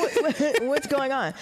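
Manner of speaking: ordinary talk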